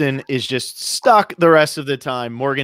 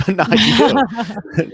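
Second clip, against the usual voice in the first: laughing; 8 semitones higher, much slower than usual. Real, not acted. Teasing laugh at cohost.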